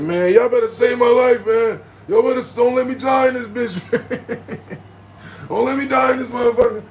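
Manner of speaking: high, loud comic begging; acted frantic pleading. Patient begging paramedics after hearing fatal, loud frantic plea. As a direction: plead for your life, hammed up with a laugh breaking in.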